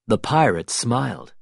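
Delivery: ordinary delivery, nothing marked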